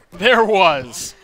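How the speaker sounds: usual speaking voice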